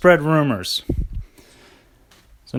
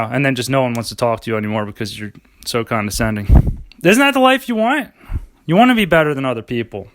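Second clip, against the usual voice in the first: ordinary delivery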